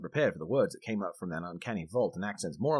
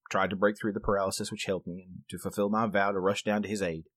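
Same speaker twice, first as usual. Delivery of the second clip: usual speaking voice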